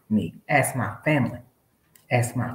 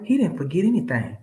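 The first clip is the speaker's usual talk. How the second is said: normal talking voice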